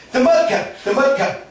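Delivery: loud, high blurted cry; excited outburst. Acted. Krishna suddenly blurting out to Dauji, excited cry. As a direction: blurt it out as if you just had an idea. Krishna thinking quick, exclaiming.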